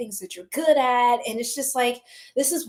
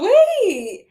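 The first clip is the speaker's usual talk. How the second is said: surprised; 10 semitones higher, 6 dB louder than usual. Real, not acted. Sudden realization.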